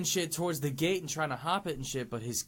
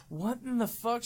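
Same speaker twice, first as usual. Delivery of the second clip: higher, mildly acted scared shock; retold fright, pitched up; freaking out; 7 semitones higher than usual. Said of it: "She's all freaking out, like, all scared".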